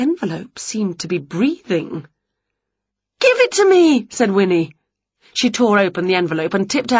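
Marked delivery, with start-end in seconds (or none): 3.3-3.9 high loud grabby witch; snatch it with your voice; impatient witch, much higher and louder; eager demand. Acted. Winnie grabbing envelope.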